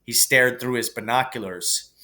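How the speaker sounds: ordinary delivery, nothing marked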